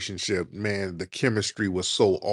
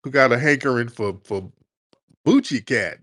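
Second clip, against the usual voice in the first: usual speaking voice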